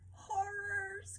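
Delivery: high, hammed-up gasp of horror; mock horrified cry. A narrator's reading. Crab crying out as the boat sinks, hammed-up horror. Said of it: "cried the crab".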